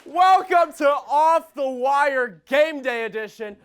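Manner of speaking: high, slow, stretched-out welcome; big drawn-out host intro; show-host announcement. Real, not acted. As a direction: announce the show to a hyped crowd.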